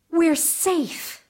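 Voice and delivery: a light breathless gasp of relief. A narrator's reading. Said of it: "Fifine gasped".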